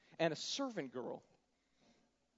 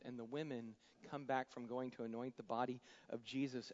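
usual speaking voice

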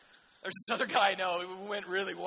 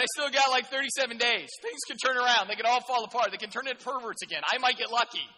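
Jonah's attitude; 4 semitones higher, 9 dB louder than usual. Retold or imitated. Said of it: "That's, that's his attitude".

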